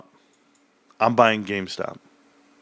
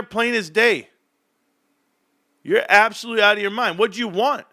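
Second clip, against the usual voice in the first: exasperated rant; 8 semitones higher than usual. Real, not acted. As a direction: scold the doubter, worked up. Heated rant at chat viewer.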